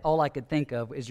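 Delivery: ordinary delivery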